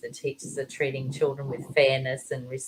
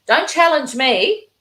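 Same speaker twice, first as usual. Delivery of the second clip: stern teacher threat; 8 semitones higher, 7 dB louder than usual. Retold or imitated. Strict teacher threatening pupils, louder and higher. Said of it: "hear some of them sort of saying things like".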